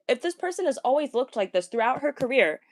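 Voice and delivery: usual speaking voice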